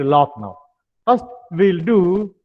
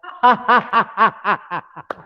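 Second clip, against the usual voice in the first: hearty open laugh; open-mouthed laugh; 3 semitones higher than usual. Shown as a demonstration. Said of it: "Open your mouth and laugh now".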